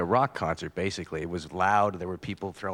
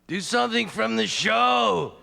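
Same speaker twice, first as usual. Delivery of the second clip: high drawn-out shout, much higher and louder; yelled heckle; 5 dB louder, slower than usual. Retold or imitated. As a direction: yell it slowly like a heckler in the crowd. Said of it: "until somebody yelled out".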